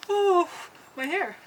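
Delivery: sharp high gasps from the cold; shocked by freezing water; gasping from ice water. Real, not acted. Just got into ice bath.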